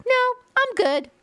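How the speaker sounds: exaggerated high refusal; mock brush-off. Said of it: "how many people are like".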